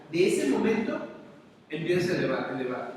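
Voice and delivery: normal talking voice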